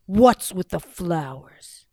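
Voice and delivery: slow, loud, gruff question; suspicious giant. Acted. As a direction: growl it suspiciously.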